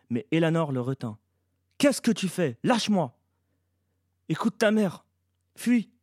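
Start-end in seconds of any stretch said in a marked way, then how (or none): 1.8-3.2 high, loud demand; angry protest. A narrator's reading. Boy struggles as Eleanor holds him back, angry. 4.3-5.9 high, loud panicked order; terrified. A narrator's reading. Eleanor urges the boy to flee, terrified. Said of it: "lui dit-elle terrorisée".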